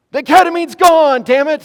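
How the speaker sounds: high, loud exasperated cry; frustrated outburst. Retold or imitated. Waking mid-procedure, frustrated.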